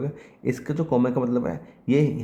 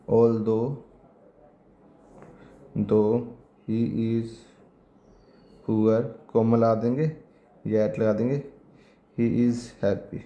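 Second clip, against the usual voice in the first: slow dictation; much slower than usual. Shown as a demonstration. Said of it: "dictating example slowly".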